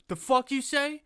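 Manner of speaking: high, loud mock outrage; angry retort. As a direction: bark it back like a kid picking a fight. Angry kid at lunch table.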